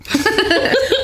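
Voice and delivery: high loud burst of laughter. Real, not acted.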